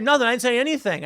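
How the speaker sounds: high-pitched retold protest; imitating someone caught out; mock defensive denial. Retold denial before getting hit.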